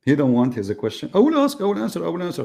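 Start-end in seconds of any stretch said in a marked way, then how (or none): 1.1-2.4 lightly voiced, high mock of the caller. Host mocks the caller's promise to his audience, high and taunting. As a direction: parrot his promise in a mocking squeak. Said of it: "They come and say".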